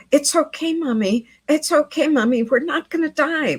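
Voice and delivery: put-on high little-boy voice saying it'll be fine; small child comforting mom. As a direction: voice a little kid soothing his scared mother. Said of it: "her small child saying to her".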